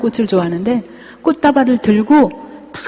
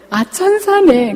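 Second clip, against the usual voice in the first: realizing; 8 semitones higher, slower than usual. Real, not acted. Speaker realizes they are angels.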